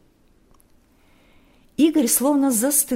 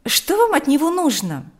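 higher, louder, irritated demand; 6 semitones higher, 5 dB louder than usual. A narrator's reading. Марьяна answers the father's phone call, irritated. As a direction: snap it with annoyance. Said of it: "раздражённо спросила Марьяна".

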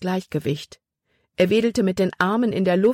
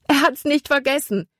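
high, joyful relieved call; 9 semitones higher than usual. A narrator's reading. Libby calls to Caleb in relief that Jordan remembers. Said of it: "rief sie".

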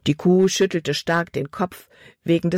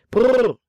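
higher, louder rolled "brrr"; horse-halting brrr; 7 semitones higher, 5 dB louder than usual. A narrator's reading. Driver pulling up the wagon, high trilled brrr. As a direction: trill it loudly, falling at the end.

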